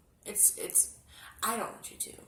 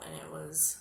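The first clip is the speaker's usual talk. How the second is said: ordinary delivery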